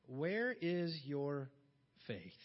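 slow, low, soft question; Jesus quietly asking the disciples about faith; slow rebuke. Retold or imitated. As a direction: draw out each word of the rebuke.

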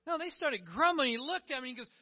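normal talking voice

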